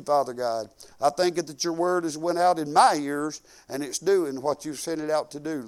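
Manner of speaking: ordinary talk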